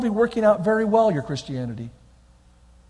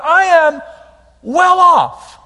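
loud proclamation; 7 semitones higher, 8 dB louder, much slower than usual. Acted. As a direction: punch well off, high and loud. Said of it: "building declaration".

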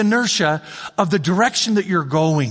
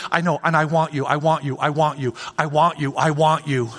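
tender God; 5 semitones lower than usual. Acted. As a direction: say it gently like a loving father.

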